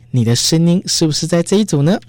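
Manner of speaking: ordinary delivery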